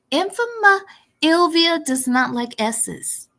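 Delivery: Infama Ilvia with the S sounds left off; dropping the S. Shown as a demonstration. Dropping her S to spite her. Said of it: "if she doesn't like S's, I'm not gonna give her S's".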